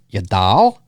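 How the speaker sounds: a light Chicago twang on ya doll; mild Chicago accent, rising. Retold or imitated. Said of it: "Such a Chicago accent".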